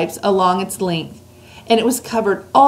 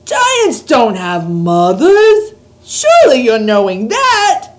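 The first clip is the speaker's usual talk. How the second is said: loud, slow astonished giant; astonished cry; 4 semitones higher, 8 dB louder, slower than usual. A narrator's reading. Giant astonished at Sophie's question, loud and slow. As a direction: recoil at the very idea.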